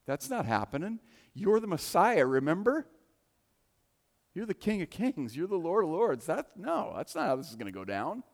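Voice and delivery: insist, no way this happens; excited denial from a disciple who won't accept it; Peter protesting. Retold or imitated. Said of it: "And Peter's like".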